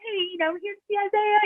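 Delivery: high, soft, mock-nervous greeting. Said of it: "voice goes up like an octave 'cause I'm nervous".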